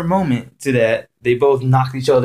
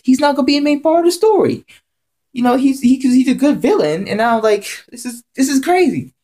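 worked-up rant; 11 semitones higher than usual. Real, not acted. Ranting about the plot twist.

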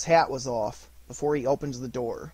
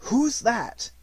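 higher, louder sudden question; Fred startled, higher and louder; 9 semitones higher, 5 dB louder than usual. A narrator's reading. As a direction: demand who has come in.

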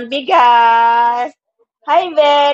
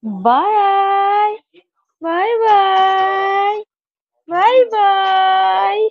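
higher sing-song bye-bye; 8 semitones higher, much slower than usual. Real, not acted. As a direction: wave bye to the little one, higher and cooing. Waving bye to baby.

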